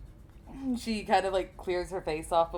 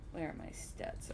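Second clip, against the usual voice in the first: normal talking voice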